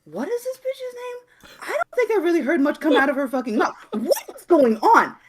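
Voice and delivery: high-pitched, slowed, half-crying mock disbelief; exasperated disbelief. Ranting friend mocks his own disbelief at the movie. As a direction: whine it out in mock despair at the screen. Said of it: "and I'm like".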